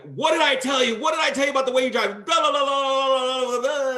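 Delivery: high, loud, fast mock tirade, half-acted; dad's fake yelling. Dad yelling at his son in front of the cop, hammed-up shouting. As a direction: shout it like a furious father putting on a show. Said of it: "he starts yelling".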